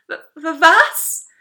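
high, loud stammered disbelief; stammer, then burst out appalled; dumbfounded stutter; aghast. A narrator's reading. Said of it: "fragte ich entgeistert".

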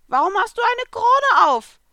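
squeaky cartoon cat, exclaiming; Happy the cat. A narrator's reading. The little blue cat explaining to Natsu, high and excited.

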